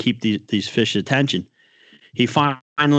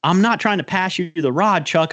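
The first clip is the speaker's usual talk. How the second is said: retold yell; 7 semitones higher than usual. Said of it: "I'm like".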